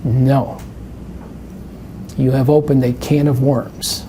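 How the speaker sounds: ordinary talk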